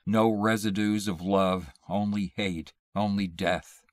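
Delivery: usual speaking voice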